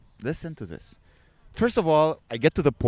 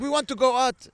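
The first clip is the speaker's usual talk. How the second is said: pushy husband; 8 semitones higher, much faster than usual. Retold or imitated. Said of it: "And he's like".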